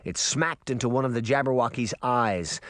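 ordinary delivery

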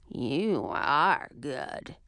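low, slow growl; growling witch. Acted. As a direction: growl it like an old crone. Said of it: "the old witch growled".

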